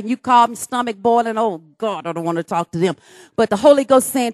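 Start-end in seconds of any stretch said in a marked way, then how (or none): 1.4-3.0 low, soft, grudging complaint; reluctant groan. Retold or imitated. Preacher imitating a believer who won't apologize, grudging. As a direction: drag it out unwillingly.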